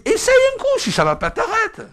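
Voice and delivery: high, loud encouraging push; eager coaxing. Retold or imitated. Preacher coaxing the timid sister to try the library, high and loud. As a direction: nudge her to just try it.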